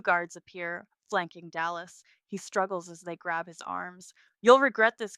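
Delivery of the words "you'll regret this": loud, raised threat; angry threat. A narrator's reading. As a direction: hurl it while being dragged off. Dragged away, shouting threats.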